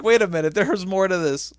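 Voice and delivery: loud, high dawning realization; retold excited gasp of discovery. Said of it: "I was like".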